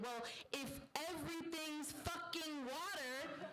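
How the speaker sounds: high, slow, drawn-out acted outburst; ranting outburst, stretched word by word. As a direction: raise the pitch and stretch it out in exasperation. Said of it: "raising his voice even louder".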